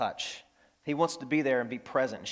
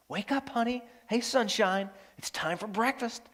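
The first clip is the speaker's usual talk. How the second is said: tender wake-up; 6 semitones higher than usual. Acted. A parent waking a child for breakfast, tenderly. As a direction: gently coax a sleepy child awake. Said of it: "It's very tender".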